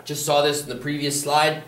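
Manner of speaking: ordinary talk, nothing marked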